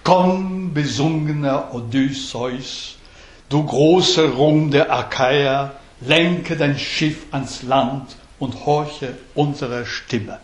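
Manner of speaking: slow German verse recital, stressing the beats; scanned German hexameter. Shown as a demonstration. Teacher reciting German verse for the students, slow and rhythmic. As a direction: read the lines slowly, leaning on each beat. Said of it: "Ce sont les vers que je vous ai lus".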